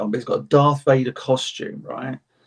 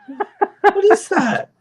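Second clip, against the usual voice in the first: very high, louder squeal of disbelief; mock astonishment; 5 dB louder, much slower than usual. Reacting to a friend's tale of light-up braces, squealing disbelief. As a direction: squeak it out through a laugh, aghast. Acting shock at flashing braces.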